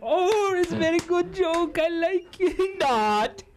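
high-pitched mock-foreign accent, hammed up; Borat. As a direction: put on a squeaky comic Kazakh accent. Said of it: "I'm not gonna do the Borat jokes".

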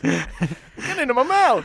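slow, high mock West African accent; hammed-up Clifford in a West African accent. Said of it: "Do it again, please".

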